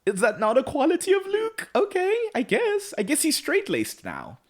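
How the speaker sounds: very high, incredulous questioning; bemused reaction. Retold or imitated. Viewer puzzled by a character's change, high-pitched and bemused. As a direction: pitch it way up, doubtful and conceding. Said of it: "And I was like".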